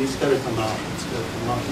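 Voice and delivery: very soft, low, intimate; hushed Holy Spirit voice. Retold or imitated. Preacher voicing the Holy Spirit to himself, soft and low. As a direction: voice God as a near whisper. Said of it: "and the Holy Spirit saying".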